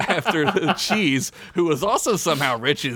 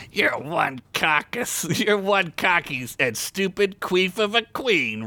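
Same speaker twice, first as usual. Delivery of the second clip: slow, higher-pitched jeer; mocking taunt; 6 semitones higher than usual. A narrator's reading. Rick laughing at his rival, lightly mocking taunt. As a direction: jeer slowly, relishing the insult. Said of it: "Rick said, laughing".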